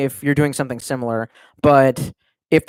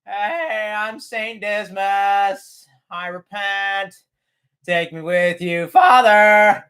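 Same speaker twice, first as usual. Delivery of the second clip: slow, high and drawn out, hammed-up repentant plea; pleading saintly prisoner; 7 semitones higher, much slower than usual. Captured vampire on the phone hams up a slow, high repentance. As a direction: beg like a hammy martyr begging for rescue. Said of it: "in the background you can hear".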